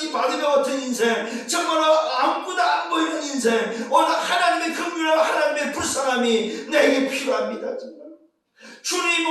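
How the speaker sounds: high, pleading prayer voice; fervent prayer. Shown as a demonstration. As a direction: pray it out as if alone before God. Models a prayer for the congregation. (하나님께 간절히 부르짖는 기도)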